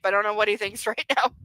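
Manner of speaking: ordinary talk, nothing marked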